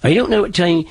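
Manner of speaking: put-on old East Anglian dialect demonstration; East Anglian settler accent. Shown as a demonstration. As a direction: speak like a Norfolk settler at Plymouth Rock. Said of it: "they sounded like this".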